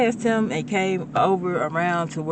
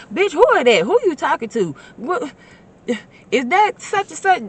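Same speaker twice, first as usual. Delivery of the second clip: high and loud, demanding answers; a homegirl yelling across the room; nosy excited shout; 11 semitones higher, 8 dB louder than usual. Retold or imitated. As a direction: squawk like a friend butting in.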